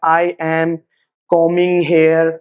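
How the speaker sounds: "I am combing hair" very slow, word by word; drawn-out robotic "I... am... combing... hair"; unnatural uncontracted English. Shown as a demonstration. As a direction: separate each word of "I am combing hair", no contraction. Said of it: "हम ये English नहीं बोलेंगे".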